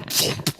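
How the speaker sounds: sudden popped thump noise; imitated jolt thump. Them things they give you for heart attack, imitated thump. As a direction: make the punchy sound of a defibrillator hit.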